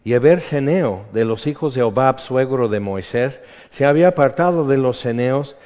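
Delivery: preacher declaiming a Bible passage; scripture reading. Acted. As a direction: read the verses out to the congregation. Reads scripture aloud.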